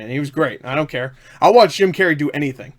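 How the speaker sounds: ordinary talk, nothing marked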